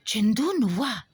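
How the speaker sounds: can it really be you; unbelieving surprise. A narrator's reading. Roja recognizes her brother in the tree, astonished. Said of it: "నమ్మలేనంత ఆశ్చర్యంగా".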